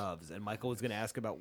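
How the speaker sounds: normal talking voice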